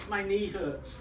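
lightly acted feeble complaint; feeble inner voice. Little voice excusing itself from the jump, feeble. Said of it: "the little voice goes".

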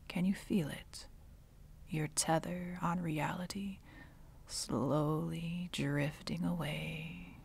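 soft, trailing near-whisper; softly ushering someone toward death; hushed, eerie calm. Acted. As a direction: let the words drift slowly away. Villainess describes hero's life slipping away.

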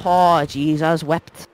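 excited Irish brogue, dropping to a hush; hammed-up Irish accent winding down; mock Irish. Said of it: "speaking Irish".

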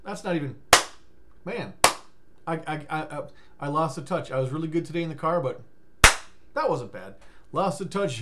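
usual speaking voice

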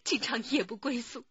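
ordinary talk

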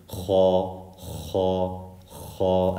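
"kha" with a heavy scrape at the back of the throat; throaty kha. Shown as a demonstration. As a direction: rasp the kh of "kha", not an English k. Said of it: "learn how to say".

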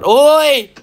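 high, loud startled yell; sudden loud outburst; startled shout. Real, not acted. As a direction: shout out in shock. Jolted by the game.

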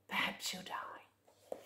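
hushed spooky whisper. Acted. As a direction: whisper the line to the child. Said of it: "whispered refrain".

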